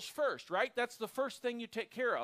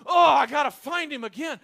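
frantic shout, much louder and higher; stressed; 6 semitones higher, 15 dB louder than usual. Pretend. Preacher acting a stressed seeker, frantic loud cry. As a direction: panic like you've lost him. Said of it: "like you're stressed".

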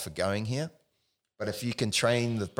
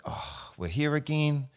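weary resignation; 8 semitones higher, slower than usual. Retold or imitated. Nervous system resigned.

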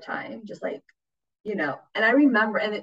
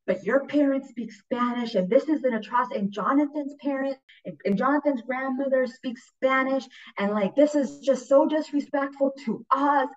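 complaining dad; 7 semitones higher than usual. Retold or imitated. Dad griping at his daughter about the mass, mock indignant. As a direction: protest the insult to the family. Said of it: "And he goes, like".

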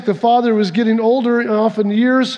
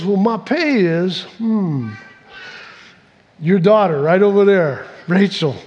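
name the price with a sly, lowered voice; 3 semitones lower than usual. Retold or imitated. Jacob naming Rachel as his pay to Laban, lowered and sly.

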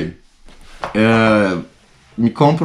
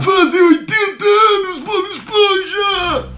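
very high, slow cartoon wail; Patrick; slower than usual. Podcaster imitating Patrick to SpongeBob, very high drawn-out wail. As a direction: wail it out, stretching every word high. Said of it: "uma imitação do Patrick".

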